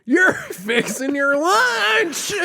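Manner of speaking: very high, slow Southern squeal; mock Southern accent. Host mimicking his co-host, in a squealed Southern accent. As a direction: imitate the twang way up high, stretching LUNCH.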